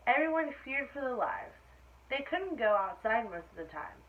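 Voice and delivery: put-on lively read-aloud for children; animated story reading; storybook narration. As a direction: tell it like a bedtime picture book. Reads picture book aloud.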